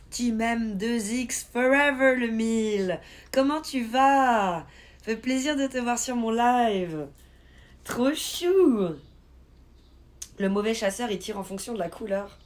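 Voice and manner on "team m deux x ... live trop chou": high, loud, drawn-out hype; excited welcome. Real, not acted. Streamer welcomes a new follower to the live, excited. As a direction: gush a stretched-out welcome to a fan.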